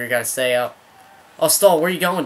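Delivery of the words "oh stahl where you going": high, loud cry at a player; frustrated gamer outburst; exasperated scolding. Real, not acted. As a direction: call out in annoyed disbelief.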